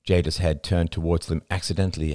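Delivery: plain reading voice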